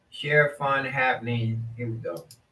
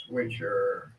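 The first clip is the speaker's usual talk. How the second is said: talking between sips of juice; words around a sip of drink; while sipping a drink; 4 dB quieter, slower than usual. Real, not acted. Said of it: "I'm drinking this".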